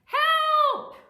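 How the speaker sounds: very high, very loud drawn-out cry; yell for help. Acted. Jack fleeing down the beanstalk, yelling for help. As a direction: scream it out as a call for rescue. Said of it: "he yelled".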